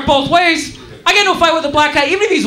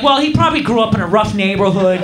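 lower, calm, self-righteous reasoning; mock reasonable liberal; 8 semitones lower than usual. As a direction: sound like a pompous understanding commuter. Passengers rationalising in mock voice.

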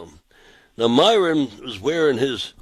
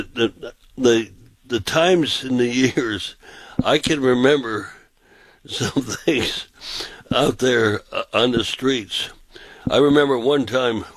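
ordinary talk

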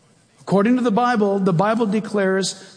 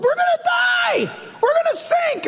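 hammed-up panicked shriek, much higher; panicked cries. Disciples crying out in the storming boat, mock panic. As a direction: squeal in terror. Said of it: "All the guys are crying in the boat".

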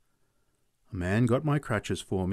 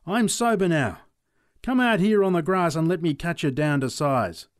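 higher, louder call to fight; challenging yell; 6 semitones higher, 5 dB louder than usual. Retold or imitated. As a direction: shout a dare across the paddock. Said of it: "I yelled at him".

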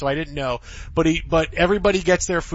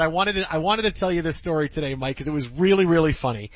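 ordinary talk

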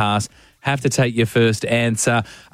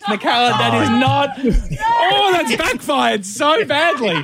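dismayed shout; 13 semitones higher, slower than usual. Real, not acted. Co-host protests the caller's answer, half laughing. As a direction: yelp in mock horror at the caller.

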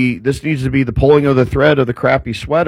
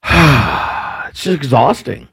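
slow, sighing exasperation; weary sigh; much slower than usual. Real, not acted. A host griping about people who demand everything, weary sigh. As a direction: let the sigh drag out the words.

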